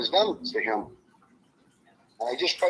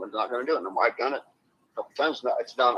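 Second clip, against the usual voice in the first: normal talking voice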